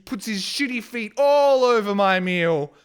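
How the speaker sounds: angry ranting narration; real angry rant. Real, not acted. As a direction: tell it furious, still seething. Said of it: "I'm very angry".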